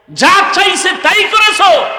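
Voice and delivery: high, full-voiced preacher's shout; sudden high, ringing shout; shouted reproach. Real, not acted. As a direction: hurl the accusation out at the crowd. Same reproach repeated as a shout.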